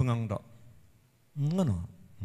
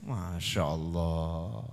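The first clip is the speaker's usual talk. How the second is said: awed sigh; 12 semitones lower, 9 dB quieter, much slower than usual. Real, not acted. Awed exclamation.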